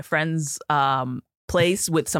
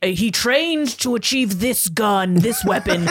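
slow, grand mock-heroic reading; hammed-up storybook voice, slow; mock epic narrator; 5 semitones higher, slower than usual. Mock-epic voice mocking the book's line about guns. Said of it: "And he's like".

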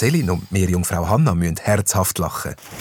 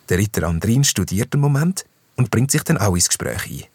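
ordinary delivery

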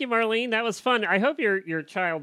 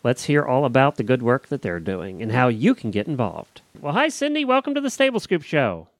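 louder, upbeat announcer read for the next guest; radio host hyping the upcoming guest, louder announcer voice; radio show intro; 4 semitones higher, 7 dB louder than usual. Acted.

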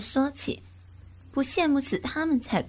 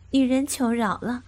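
usual speaking voice

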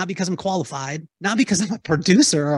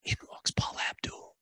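hushed whisper for the big reveal; dramatic whisper; 14 dB quieter than usual. Real, not acted. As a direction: whisper it like a secret. Dramatic reveal of star arriving.

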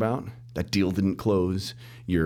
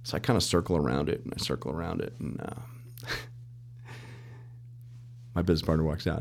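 ordinary delivery

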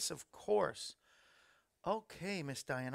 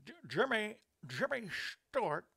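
a stammering, higher attempt at Jimmy Stewart; 4 semitones higher than usual. Shown as a demonstration. Host attempting a Jimmy Stewart impression for his birthday. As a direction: try a halting Jimmy Stewart stutter on the name. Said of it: "That's the best I can do".

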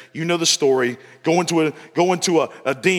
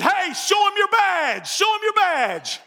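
higher and slow, hammed-up calling out; mock farmer shout; slower than usual. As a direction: yell across the field, enjoying it. Farmer calls to chased inspector.

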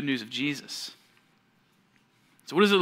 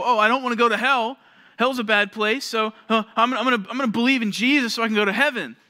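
higher, louder, quick hammed-up eagerness; naive eager convert; 8 semitones higher, 7 dB louder than usual. Shallow believer choosing Jesus to avoid hell, hammed-up eager. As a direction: sell it like a kid grabbing a ticket to heaven.

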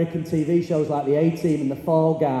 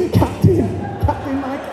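mock Dead Poets salute; 7 semitones higher than usual. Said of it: "I'm at the back going".

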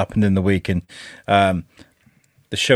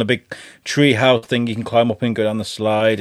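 ordinary talk, nothing marked

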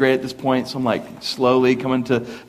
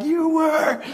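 teasing mom reply. Retold or imitated. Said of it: "My mom's like".

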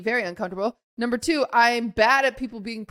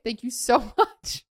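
laughing through it. Real, not acted. Laughs at her own awkward exit.

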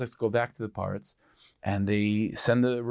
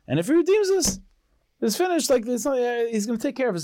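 much higher, elated and certain; overjoyed; 12 semitones higher, 3 dB louder than usual. Retold or imitated. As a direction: soar up with pure relief and joy. Yankel overjoyed after the drasha.

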